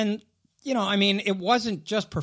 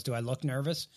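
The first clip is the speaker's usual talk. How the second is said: low, quiet deadpan retelling; understated deadpan question; 6 semitones lower, 8 dB quieter than usual. Retold or imitated. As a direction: imitate an unbothered player, low and soft. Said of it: "looked with this deadpan look".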